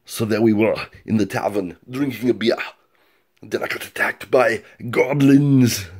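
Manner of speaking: put-on milder Austrian-accented strongman voice; restrained Austrian action-hero storytelling; toned-down Arnold Schwarzenegger. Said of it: "tone it down a little".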